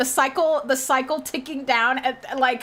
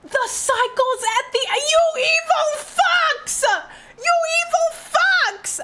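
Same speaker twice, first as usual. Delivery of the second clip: very high wailing accusation; anguished outcry; 13 semitones higher than usual. Real, not acted. Streamer cursing the makers over the ending, anguished wail.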